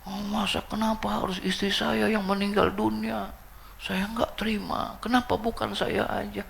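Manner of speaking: high-pitched mock lament; imitated weeping. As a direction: wail like an overwrought widower, hammed-up. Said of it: "nangisnya bukan main tuh laki".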